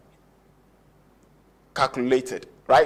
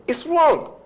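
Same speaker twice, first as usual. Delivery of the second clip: sudden high "It's wrong"; marking it wrong; 12 semitones higher than usual. Shown as a demonstration. Teacher pointing out a wrong sentence to students, high and emphatic. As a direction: jump way up to flag the mistake. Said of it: "teacher marking an error".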